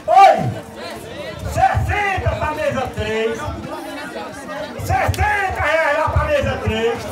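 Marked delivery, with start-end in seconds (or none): 1.5-7.0 higher shouted auction call; shouted bid. Real, not acted. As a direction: shout the bid across the hall. Auctioneer shouting bids across hall.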